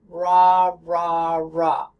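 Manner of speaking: ra with an American R. Shown as a demonstration. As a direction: say ra with a curled English R. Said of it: "American R".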